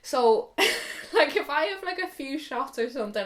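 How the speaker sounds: normal talking voice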